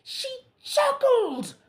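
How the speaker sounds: very high, slow whispered sob; hammed-up sobbing king; crying. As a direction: weep the words. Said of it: "cried the king".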